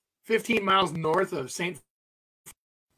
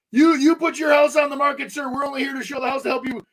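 high loud hurried plea; panicked pleading; 7 semitones higher, 6 dB louder than usual. Retold or imitated. Realtor begging the armed homeowner, panicked loud plea. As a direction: talk fast to calm an armed man.

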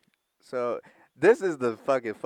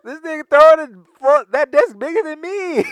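outraged; 13 dB louder than usual. Real, not acted. Outraged retelling.

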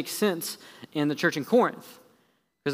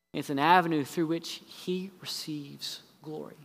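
whispering; 5 dB quieter than usual. Real, not acted. Preacher drops to a whisper.

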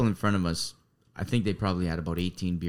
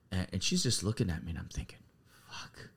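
hushed whisper; 2 semitones lower, 10 dB quieter than usual. Retold or imitated. Telling friends about the staring girl, whispered. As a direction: drop to a near-silent whisper.

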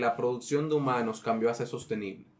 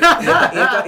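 laughing; 12 semitones higher, 14 dB louder than usual. Real, not acted. Laughing at own misinformation joke.

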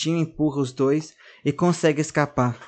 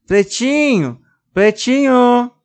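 calling out; 9 semitones higher, 8 dB louder, much slower than usual. Acted. Girl calling for lost doll.

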